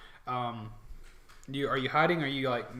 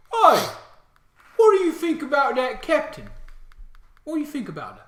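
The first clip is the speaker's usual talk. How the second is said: high, loud orc calling across; orc soldier; 13 semitones higher, 12 dB louder than usual. Acted. Orc asking his comrade about the captain, orc voice. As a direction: shout the question as a nosy orc. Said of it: "you hear one of them from the other and say".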